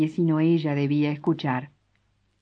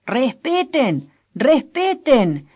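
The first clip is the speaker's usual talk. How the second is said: slow, drawn-out shout, much higher and louder; 9 semitones higher, 7 dB louder, much slower than usual. Acted. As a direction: bellow for order from across the patio. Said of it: "se oyó gritar a Frutos Pareja". (gritar lento y autoritario)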